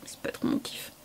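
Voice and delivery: very soft whispered verdict; whispered aside. Real, not acted. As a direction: slip it in under your breath, whispering. Avis négatif glissé en aparté. (aparté chuchoté)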